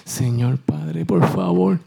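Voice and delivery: mock a long formal prayer; mock pious prayer. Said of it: "No dijo".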